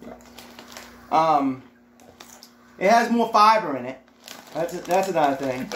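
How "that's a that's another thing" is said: usual speaking voice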